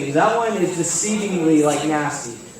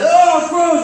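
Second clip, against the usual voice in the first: higher and louder, grossed-out cry; mock disgusted brother; 10 semitones higher, 7 dB louder, slower than usual. Pastor imitating brother reacting to a gross bean, loud and high. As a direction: act out the brother's revulsion. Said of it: "My other brother's like".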